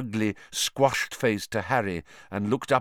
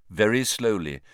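plain reading voice

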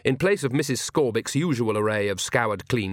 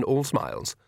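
ordinary delivery

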